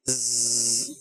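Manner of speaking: low buzzing zz sound; imitating a plane's buzz; buzzing imitation. Shown as a demonstration. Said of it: "تو آواز آتی ہے، کیا؟".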